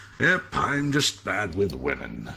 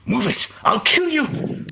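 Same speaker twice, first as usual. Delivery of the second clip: much higher, louder, slow threatening yell; furious shout; 6 dB louder, slower than usual. Acted. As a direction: scream at them to get out of the way. Robber shouts at crowd.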